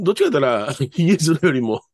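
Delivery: normal talking voice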